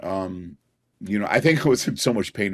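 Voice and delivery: ordinary talk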